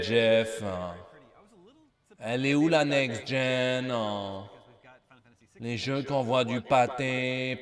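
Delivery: slow, drawn-out taunting question; slow mock lament. Taunting the show organiser, slow drawn-out mock lament. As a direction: drag every word out like a mock complaint.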